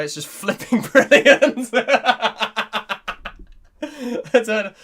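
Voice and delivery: can barely get the words out for giggling; laughing. Real, not acted. Reading a viewer's comment aloud, giggling. Reading chat while cracking up.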